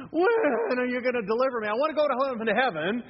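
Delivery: high, loud mock-despairing plea; hammed-up wail. Preacher voicing a worn-out believer crying to God, hammed-up wail. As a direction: wail it to heaven, overacted.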